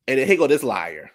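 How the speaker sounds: usual speaking voice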